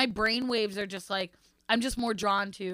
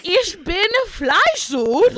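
loud, high, drawn-out mock German; over-the-top German accent, very slow; exaggerated German accent; 8 semitones higher, 8 dB louder, much slower than usual. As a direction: belt it out in hammed-up German. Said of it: "I would literally say it like that".

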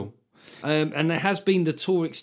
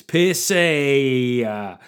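mock hail; 6 dB louder, much slower than usual. Calling out to patron.

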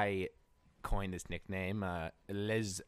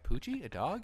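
confused; 8 semitones higher than usual. Retold or imitated. Confused reply to homeless man.

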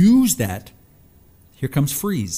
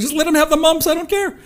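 exasperated; 5 dB louder than usual. Retold or imitated. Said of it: "I was like".

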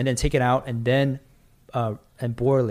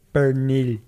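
Spanish accent. Shown as a demonstration. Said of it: "I'm trying to get that Spanish accent".

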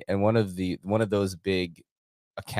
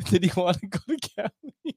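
higher-pitched, laughing mock quote; laughing mimicry; 8 semitones higher, 3 dB quieter than usual. Host mocking the angry plane passenger, laughing mimicry. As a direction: laugh through the imitation.

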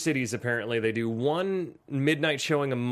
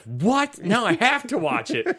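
imitating wife's reaction; 6 semitones higher than usual. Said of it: "she was like".

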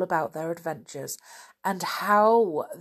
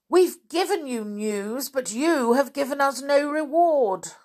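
high-pitched indignant crowd complaint; shouted protest; 7 semitones higher, 4 dB louder than usual. Acted. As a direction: protest loudly on behalf of the crowd. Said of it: "suddenly shouted someone".

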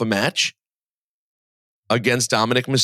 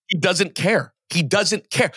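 higher-pitched emphatic insistence; emphatic exasperation; 7 semitones higher than usual. Real, not acted. Host arguing little kids don't care about wrestlers, emphatic exasperation.

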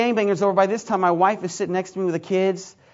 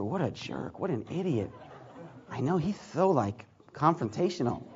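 much softer, muttered insult; whispering; 5 semitones lower, 10 dB quieter than usual. Retold or imitated. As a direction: gossip about him behind his back. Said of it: "I could hear them whisper".

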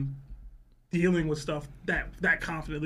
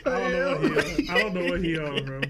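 laughing. Real, not acted. Laughing at a friend.

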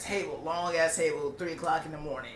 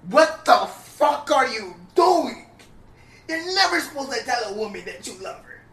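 incredulous yell; 8 semitones higher, 10 dB louder than usual. Retold or imitated. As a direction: throw the question at him in disbelief. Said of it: "And I look at him like".

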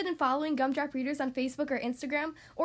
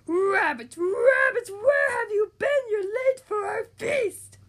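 put-on high, loud, wailing wolf boy; a hungry wolf pup crying out to his friends; excited wolf boy; 7 semitones higher, 9 dB louder, much slower than usual. Wolf Boy greeting rabbits.

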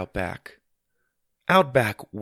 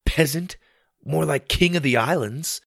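put-on boy scoffing; 6 semitones higher than usual. Boy mocks sister's title.

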